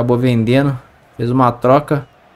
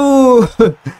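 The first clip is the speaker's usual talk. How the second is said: excited laugh; 10 semitones higher, 5 dB louder than usual. Real, not acted. Laughing after the goal.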